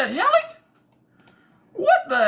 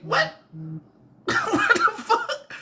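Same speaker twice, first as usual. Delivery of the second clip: real baffled laughter through the words; laughing disbelief; 2 semitones higher, slower than usual. Real, not acted. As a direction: crack up while asking what on earth. Reacting in disbelief, cracking up.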